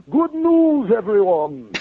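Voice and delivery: very high, loud cartoon character proclamation; cartoon announcement voice. Actor capping the host's good news, loud cartoon voice. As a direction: belt out the catchphrase in a put-on voice. Actor performs a catchphrase, hosts laugh.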